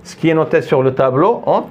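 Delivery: usual speaking voice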